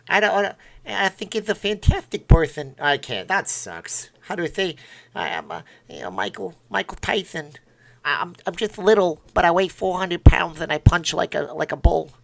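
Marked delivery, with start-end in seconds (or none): 0.1-2.7 high, loud boxer imitation; amateur cartoon-boxer impression; Mike Tyson. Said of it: "I can't do a Mike Tyson impression". 5.1-12.1 badly faked boxer voice, mostly high and loud; hammed-up amateur boxer impression; Mike Tyson. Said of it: "That's terrible".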